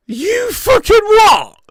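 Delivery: loud, high, drawn-out incredulous shout; outraged shout. Real, not acted. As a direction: yell it in sheer disbelief. Mock outrage at praise of Carragher.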